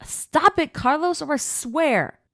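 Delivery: high, loud squealing protest; splashed by a friend, she shrieks a high protest; shrieking protest. Acted. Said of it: "I shrieked like a little girl".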